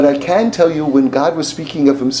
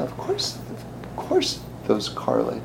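hushed mock agreement; 4 semitones lower, 11 dB quieter, slower than usual. Mock reaction.

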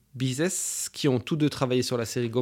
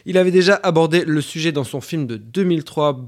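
ordinary talk

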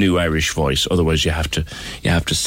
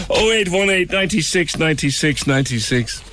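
giggly number read; 9 semitones higher than usual. Real, not acted. Reading number giddily after prank.

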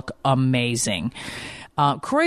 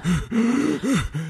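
a high hammed-up gasp like a wounded kid; mock gasp of a son playing hurt; dramatic gasp; 7 semitones higher than usual. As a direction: gasp for attention like a dramatic boy. Mother acts out son's dramatic entrance.

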